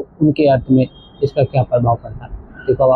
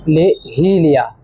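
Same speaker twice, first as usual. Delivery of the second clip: slow ले ही लिया with ही stressed; 2 semitones higher, much slower than usual. Shown as a demonstration. Teacher isolates the phrase, slow stress on ही. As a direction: slowly weigh ही. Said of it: "तो इसमें".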